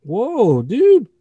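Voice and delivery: hammed-up hurt yelp at a friend; mock outrage. Real, not acted. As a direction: play wounded and shocked.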